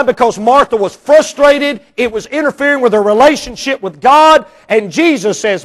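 usual speaking voice

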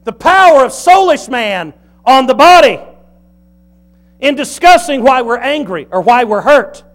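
loud, high-pitched sermon delivery; forceful pulpit proclamation; preaching at full force. Real, not acted. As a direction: drive each phrase home, loud and high.

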